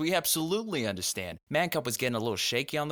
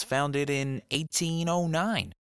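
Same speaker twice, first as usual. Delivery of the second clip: usual speaking voice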